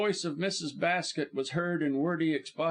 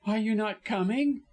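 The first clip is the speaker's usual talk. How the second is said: high-pitched urgent demand; insistent, distraught woman; vehement; 7 semitones higher, 3 dB louder than usual. A narrator's reading. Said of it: "she demanded vehemently".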